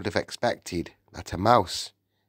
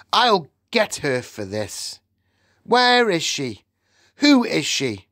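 high, indignant demands; angry shouting; 8 semitones higher, 3 dB louder than usual. A narrator's reading. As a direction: demand answers in outrage. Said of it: "he shouted".

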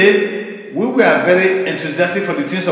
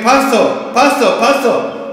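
calling out; 6 semitones higher than usual. Retold or imitated. Staff calling out for the pastor.

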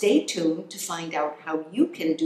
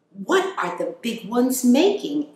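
higher, curious little-critter voice; small hamster voice; 7 semitones higher than usual. Acted. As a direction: ask it like a tiny wide-eyed hamster.

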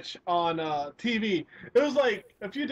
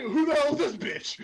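slightly higher, lightly put-on indignant voice; imitated sketch character; 5 semitones higher than usual. Retelling the possessed Satan's question, mock indignant. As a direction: mimic an affronted question through amusement. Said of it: "it sounds like".

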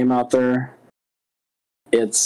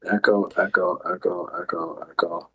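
cave echo; 8 semitones lower, 5 dB quieter than usual. Pretend. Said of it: "sounds like you're in a cave just saying echo".

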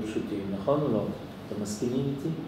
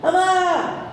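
put-on desperate child's yell, much higher; child screaming; much slower than usual. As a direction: shout for dad as if left alone. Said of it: "הבן מתחיל לצעוק".

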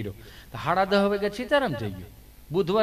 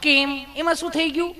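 much higher, coaxing nurse voice; sugary nurse; 8 semitones higher, 4 dB louder than usual. Retold or imitated. Nurse coaxing sweating Gabho, much higher sugary voice. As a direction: sweetly needle the sweating patient. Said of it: "nurse બેને".

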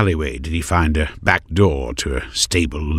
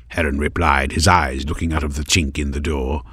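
ordinary delivery, nothing marked

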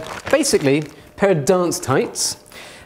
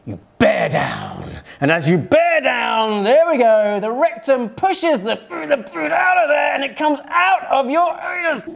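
straining mock effort; 9 semitones higher than usual. Shown as a demonstration. Presenter squeezing the model colon, straining then rising. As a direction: push it out like a big strain. Demo pushing poo out.

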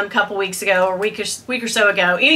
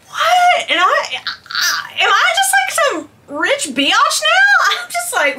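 laughing squeal. Real, not acted. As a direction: laugh it out way up high. Laughing at own good fortune.

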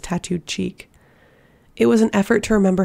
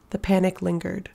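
usual speaking voice

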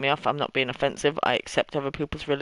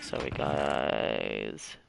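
much lower, dragged-out silly voice; deep slow voice; 8 dB quieter, much slower than usual. Pretend. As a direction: drop way down and drawl the apology. Mock apology in put-on voice.

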